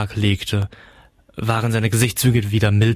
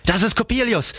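much higher, startled exclamation; horrified cry; 12 semitones higher than usual. A narrator's reading. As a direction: cry out in horror, pitched right up. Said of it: "rief ich entsetzt".

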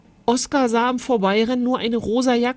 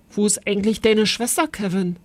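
ordinary delivery, nothing marked

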